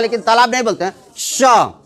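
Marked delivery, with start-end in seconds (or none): 1.2-1.7 स said as a clear "sha"; स with a whistling, hushing onset; palatal sh. Shown as a demonstration. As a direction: sound स as श from the palate. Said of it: "तालु से उसका उच्चारण होता है".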